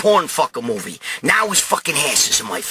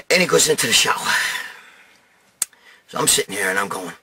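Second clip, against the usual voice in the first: normal talking voice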